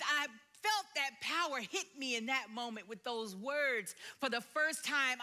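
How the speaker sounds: normal talking voice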